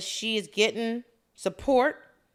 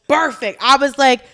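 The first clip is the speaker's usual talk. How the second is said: usual speaking voice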